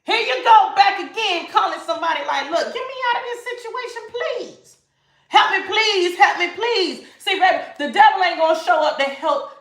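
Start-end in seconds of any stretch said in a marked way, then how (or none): 2.5-7.1 high-pitched imitation of begging for rescue; mock pleading. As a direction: play someone pleading down the phone. Voicing someone begging for help.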